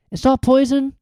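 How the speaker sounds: high, loud worried question; anxious. A narrator's reading. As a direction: ask fearing the worst. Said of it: "said Harry anxiously".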